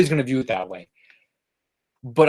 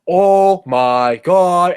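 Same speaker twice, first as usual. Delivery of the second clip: loud, higher, drawn-out neighbour's yell; imitated yell; 5 semitones higher, 6 dB louder, much slower than usual. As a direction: mimic someone yelling next door, stretching each word. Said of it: "Someone just yelled".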